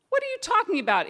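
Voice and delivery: high, fast indignant cat voice; a high-pitched cat protesting, fast. Acted. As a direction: squeak back at him in disbelief. Cat snaps back in argument.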